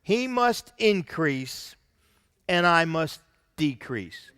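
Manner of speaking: slowed, weighty verse reading; slow emphatic reading. Shown as a demonstration. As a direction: lay each word down slowly: "He must increase, and I must decrease". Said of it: "Verse thirty".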